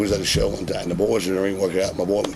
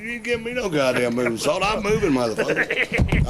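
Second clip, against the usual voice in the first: defiant refusal; 9 semitones higher than usual. Retold or imitated. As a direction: refuse him flat out, drawing out moving. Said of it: "I said".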